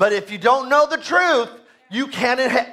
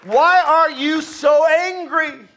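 slow, high, raised-voice demand; loud challenge; 6 semitones higher, 5 dB louder, slower than usual. Acted. Preacher confronting frustrated churchgoers, loud slow challenge. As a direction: call it out slowly, louder and higher.

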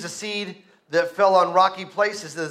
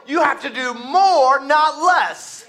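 "more" and "less" punched high and loud; forceful preaching stress on "more" and "less"; emphatic stress on more and less; 5 semitones higher, 4 dB louder, slower than usual. Real, not acted. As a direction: hit "more" and "less" hard, voice raised. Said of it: "Why do I have to put emphasis on that?".

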